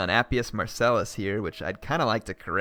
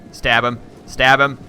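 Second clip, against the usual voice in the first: loud, higher, rising chant of orders; excited shouting; 4 semitones higher, 9 dB louder, slower than usual. Real, not acted. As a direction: yell at the troops to finish him. Urging troops on in battle.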